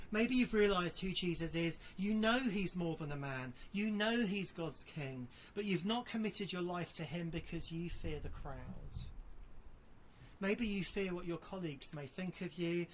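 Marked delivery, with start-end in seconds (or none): none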